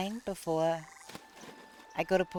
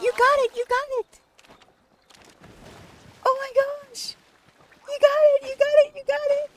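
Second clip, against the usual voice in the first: very high, loud, ecstatic shrieking; shrieking jackpot winner; 9 dB louder than usual. Real, not acted. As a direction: scream it like winning the jackpot. Friend lands a rare catch.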